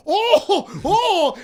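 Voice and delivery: loud, high "oh!" of shock; shocked reaction. Retold or imitated. Acting out viewers' reaction to the show, shocked. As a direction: cry out as if the twist just hit.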